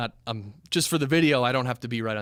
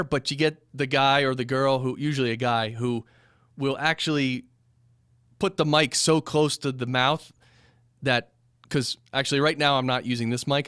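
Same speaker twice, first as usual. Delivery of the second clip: ordinary talk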